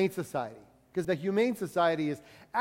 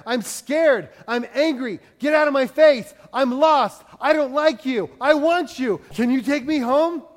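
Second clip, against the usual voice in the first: higher, louder voice of a scared dog in a cage; pleading pound dog; 6 semitones higher, 7 dB louder than usual. Acted. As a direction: speak as a lost dog begging to go home. Voices pound dogs' feelings.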